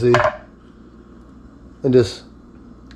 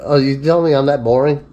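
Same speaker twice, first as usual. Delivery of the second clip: normal talking voice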